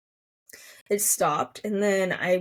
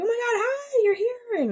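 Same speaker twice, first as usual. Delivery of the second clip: high, bright-eyed squeal of surprise; surprised delighted hi, much higher; excited greeting. Retold or imitated. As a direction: gush hello, pitch way up. Greeting nurse in story.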